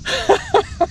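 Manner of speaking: high, soft giggle; giddy laugh. Real, not acted. Angler amused after struggling, high soft giggle. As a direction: chuckle high and quick.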